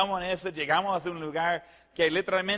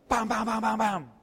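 higher run of quick "pam" hits; imitated rapid bangs; 3 semitones higher than usual. Pickup scrapes three cars' paint, storyteller imitates rapid bangs. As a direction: rattle off a string of crash noises.

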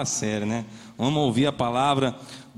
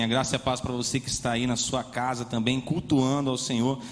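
ordinary talk

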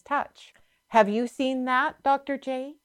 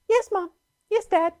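much higher imitated daughter voice; sweet, agreeable daughter; 10 semitones higher than usual. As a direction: imitate a willing child answering parents. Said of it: "that's the sweetest thing you've ever heard".

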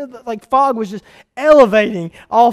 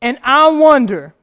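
loud, higher, slow and shouted; full-force preaching; 6 semitones higher, 9 dB louder, slower than usual. Acted. Preacher challenging Blackjack Baptist Church, shouting. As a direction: drive the question home.

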